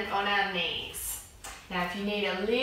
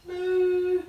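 put-on high playful moo for the kids; cow moo; 8 semitones higher than usual. Shown as a demonstration. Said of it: "make sure you make the cow noise".

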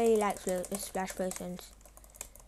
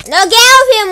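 sudden loud shout, much higher; real shout; 10 semitones higher, 19 dB louder, faster than usual. Real, not acted. Player shouting at a random attacker, loud shout.

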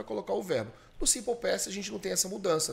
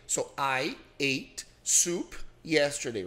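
very slow, clearly spaced; slow model answer; much slower than usual. Shown as a demonstration. Teacher corrects the student's answer, slowly. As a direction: lay out each word for the learner.

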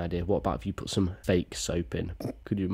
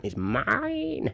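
squeaky greedy claim; 14 semitones higher than usual. Pretend. Greedy claim over found money.

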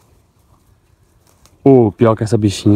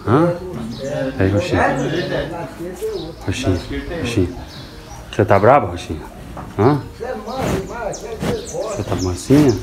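coaxing the cow; 3 semitones higher, 6 dB quieter than usual. Real, not acted. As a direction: murmur her name gently to calm her.

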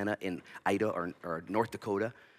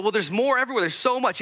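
mock excited crowd; 13 semitones higher, 7 dB louder than usual. Israelites marveling at manna.

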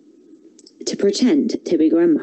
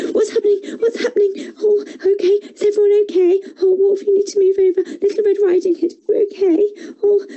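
high, fast flustered voice; flustered rushing woodcutter; 12 semitones higher, faster than usual. A narrator's reading.

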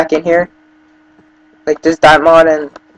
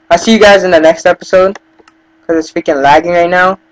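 upbeat outro; 2 semitones higher, 5 dB louder than usual. Acted. Outro sign-off to viewers.

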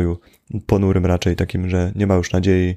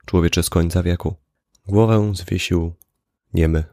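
poem recitation. Acted. A student reading the poem aloud to the class in a solemn recital. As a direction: recite each line like a grave question.

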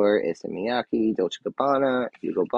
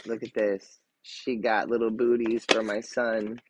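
ordinary talk

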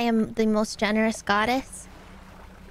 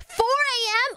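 shocked; 11 semitones higher than usual. Real, not acted. Shocked at viewers' time.

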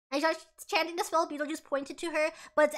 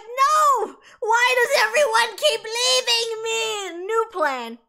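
loud, slow, crying whine; hammed-up abandoned sob; mock wail; 6 semitones higher, 8 dB louder, much slower than usual. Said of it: "And Beetlejuice is like".

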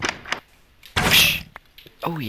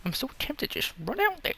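high eager rush; 14 semitones higher, faster than usual. Real, not acted. Excited urge.

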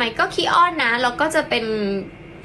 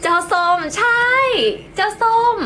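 higher, drawn-out pet call; 8 semitones higher than usual. Acted. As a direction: call the orange cat sweetly. Calling the orange cat. (เรียกแมวเสียงเล็ก)

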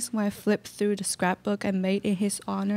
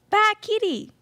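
bad kitty squeaked much higher; 13 semitones higher, 4 dB louder than usual. Cat lady scolding her cat, squeaky high scold.

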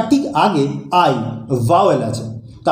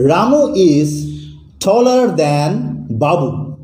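slow example reading; much slower than usual. Shown as a demonstration. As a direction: dictate the example slowly and clearly. Teacher reads example sentence slowly. (ধীরে ধীরে উদাহরণ বাক্য বলা)

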